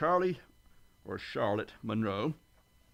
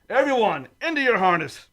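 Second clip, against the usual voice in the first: loud, higher command to brace; shouted order; 6 semitones higher, 8 dB louder than usual. Acted. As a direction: bark the order over the chaos. Captain orders crew to brace.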